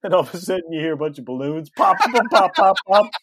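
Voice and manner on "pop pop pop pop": 1 popping noises. Retold or imitated. Imitating balloons popping.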